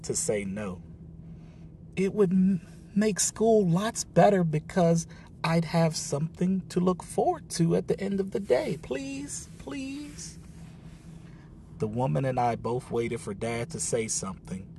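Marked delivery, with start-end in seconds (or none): 2.0-8.8 high, pleading rush of words; girl pleading to keep the rabbit; begging. A narrator's reading. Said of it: "I was begging". 8.8-10.4 very high, soft, breaking plea; girl's last tearful plea to dad; pleading please. A narrator's reading. Child begging, voice breaking.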